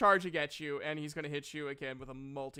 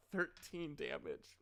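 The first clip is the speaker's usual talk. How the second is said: ordinary talk, nothing marked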